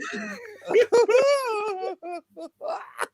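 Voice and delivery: high loud cackling laugh, near tears; shrieking laugh. Real, not acted. Host laughing hard at the exposed guest, squealing laugh.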